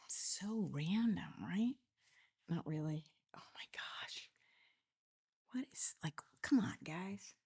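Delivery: a soft whispered aside. Real, not acted. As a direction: whisper as if telling a secret to the listeners. Hushed asides while settling in.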